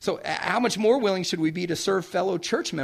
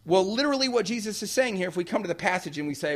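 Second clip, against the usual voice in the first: usual speaking voice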